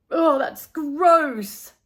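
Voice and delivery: slow, disgusted words through a pinched nose. Acted. Boy recoiling from a classmate's sandwich, slow and pinched-nosed. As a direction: hold your nose and drag it out in disgust. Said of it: "pinching his nose".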